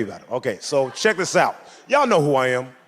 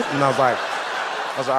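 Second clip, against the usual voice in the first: ordinary talk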